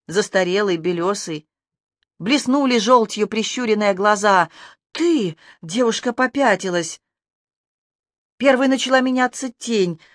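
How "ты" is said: sudden high startled cry; shocked cry. A narrator's reading. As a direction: blurt it out in shock. Girl recoils in shock.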